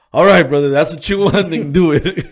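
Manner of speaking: loud, high mock concession; imitating resigned friend. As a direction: give in loudly, playing it for laughs. Friend relents after being told off.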